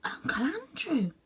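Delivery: high, slow whispered recognition; horrified whisper; shocked whisper. Acted. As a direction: let the shock come out in a hush. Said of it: "whispered Diggory".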